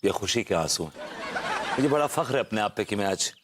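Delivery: ordinary delivery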